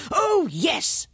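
high, exclaiming king voice; high-pitched royal recollection; blustering king. A narrator's reading. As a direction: exclaim as a flustered monarch.

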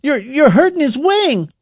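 high, loud stammered protest; frantic, high-pitched outcry; alarmed shout. A narrator's reading. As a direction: cry out to stop them. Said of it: "shouted Aaron".

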